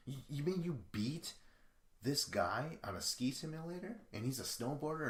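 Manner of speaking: high-pitched mock disbelief; incredulous questioning. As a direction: question it as if you can't believe it. Acting out someone questioning the win.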